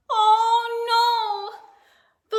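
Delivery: usual speaking voice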